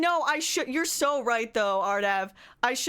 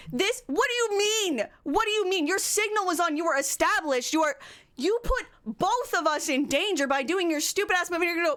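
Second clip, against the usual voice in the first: higher-pitched, indignant outburst; exasperated telling-off; real anger; 5 semitones higher than usual. Real, not acted. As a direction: scold the other driver, pitched up. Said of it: "I have never been so mad".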